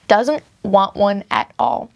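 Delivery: usual speaking voice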